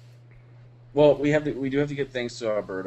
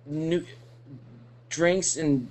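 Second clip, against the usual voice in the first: higher, halting mock-drunk rambling; imitating drunk friend; 6 semitones higher, much slower than usual. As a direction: lose the thread with long tipsy pauses. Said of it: "you were so fucking drunk".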